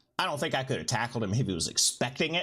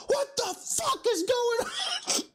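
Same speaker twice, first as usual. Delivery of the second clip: very high, drawn-out mock bellow; imitated coach yell; slower than usual. Coach confronting players at the paintball field, high mock yelling. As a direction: demand answers in a slow, strained acted shout.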